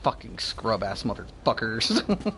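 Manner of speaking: low, soft grumbling under the breath; quiet disgusted muttering at teammates; muttered insults. Real, not acted.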